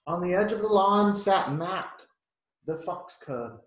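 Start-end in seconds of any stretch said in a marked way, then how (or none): none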